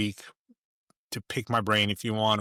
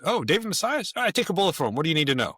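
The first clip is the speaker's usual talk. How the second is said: high, keen voice, happy to help; light up at the name and vouch gladly; a friend's quick, glad endorsement; eager vouching; 6 semitones higher, faster than usual. Retold or imitated. Colleague eagerly vouches for David.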